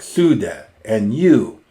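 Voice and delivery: "Su" and "you" hit loud with a falling close; emphatic su. Shown as a demonstration. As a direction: punch "Su de" and then "you". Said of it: "this su is very emphatic".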